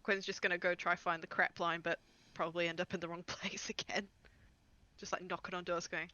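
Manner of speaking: ordinary talk, nothing marked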